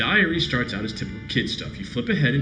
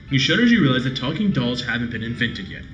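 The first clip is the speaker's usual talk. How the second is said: ordinary talk, nothing marked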